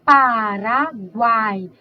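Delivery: Paraguai very slowly, every sound drawn out; slow demonstration. Shown as a demonstration. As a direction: stretch Paraguai so each sound is heard. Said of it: "Escuto tudo, né?".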